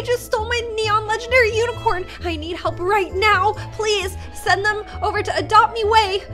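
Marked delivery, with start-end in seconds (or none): none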